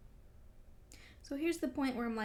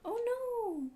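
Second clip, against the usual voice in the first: gasp of dismay; 13 semitones higher than usual. Real, not acted. Gasps reading a spoiler.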